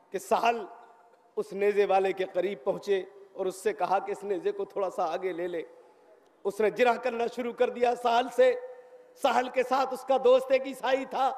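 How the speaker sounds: ordinary talk, nothing marked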